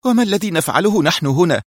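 stern Mona. A narrator's reading. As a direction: demand an answer. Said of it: "في صرامة".

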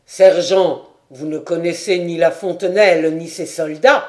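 loud, stern exclamation; cry out. A narrator's reading. Lieutenant seizes the sergeant and cries out, loud and stern. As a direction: call him out sharply. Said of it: "s'écria de Romard".

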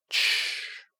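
sharp unvoiced hiss for silence; shushing. A narrator's reading. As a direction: hush someone with a breathy shh. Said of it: "шикнул серый мальчик". (резкое шипение «тш-ш-ш»)

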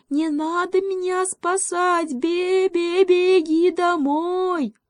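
very high, drawn-out little-goat call; bleating cry. A narrator's reading. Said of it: "закричал козлёнок". (протяжный высокий крик козлёнка с блеянием)

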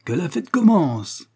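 high, drawn-out false cheer; forced mirth. A narrator's reading. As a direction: exclaim slowly with hollow gaiety. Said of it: "s'écria en riant jaune".

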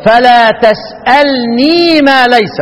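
very slow chant, تسألني with a light nun and the ya pronounced; Abu Amr reading. Shown as a demonstration. Teacher demonstrating Abu Amr's reading to the class, slow recitation. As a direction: recite تسألني slowly and sound the final ya. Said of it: "يبقى يقرأ إيه؟".

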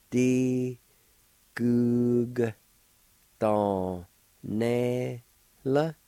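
"Di gug ton ne la" in long, separate syllables. Shown as a demonstration. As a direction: sound out "Di gug ton ne la" with pauses between beats. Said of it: "like this".

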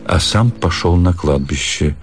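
ordinary delivery

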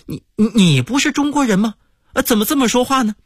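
acting out a foreigner's bewilderment; baffled questioning. American guest baffled by the hotel maid, stammering and fast. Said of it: "美国人感到莫名其妙".

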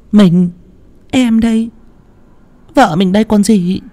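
calm him: it's only me; wife reassuring her frightened husband, high voice. A narrator's reading. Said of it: "Mụ Trang lên tiếng".

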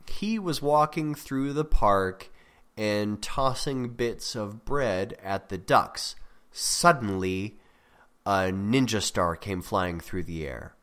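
slow, drawn-out reading with a pause after Suddenly; suspenseful example. Shown as a demonstration. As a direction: read it slowly, setting up the surprise Suddenly. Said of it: "try to catch you off guard".

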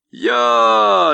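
a high, loud, lazy yell; lazy shout. A narrator's reading. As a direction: call out lazily at full voice. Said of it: "лениво крикнул он".

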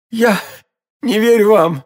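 high, strained last words in agony; anguished disbelief with a pause after the first word; dying man's refusal. A narrator's reading. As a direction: force it out in broken pieces. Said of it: "в страшных мучениях".

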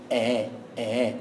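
آ as a soft glide between hamza and alif; low, slow, quiet آ without a hard catch; eased hamza. Shown as a demonstration. Teacher isolating the sound, آ as an eased hamza. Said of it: "الهمزة بتسهلها شوية".

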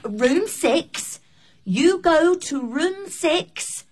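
slowed, careful words for a learner; slow and clear. Acted. Receptionist explains slowly to a guest who struggles with English, slow and clear. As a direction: spell it out slowly.

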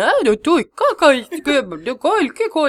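high, loud babbling; mock Swedish babble. Party host apes her dialect, high loud babble. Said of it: "For hun var sånn".

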